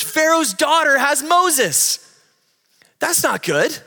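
put-on high, loud, slow kid's alarm with a laugh; panicked little sister. Sister warns mom about Pharaoh's daughter, high and panicky. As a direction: play the sister, wide-eyed and alarmed. Sister voiced in retold story.

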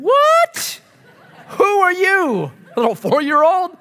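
high, loud squawk of disbelief; hammed-up shrieking outrage; incredulous dad. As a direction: imitate a flabbergasted parent. Retold outraged dad to daughter.

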